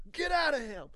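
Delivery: sudden high, loud command; commanding shout. Retold or imitated. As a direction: bark it out like casting out a demon. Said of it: "and he just goes".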